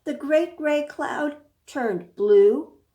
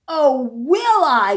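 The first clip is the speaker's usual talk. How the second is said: slow, louder grumble; grumbled; 3 semitones higher, 9 dB louder, slower than usual. Acted. Goat grumbling at the warning friend. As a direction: grumble it back sulkily. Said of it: "grumbled Goat".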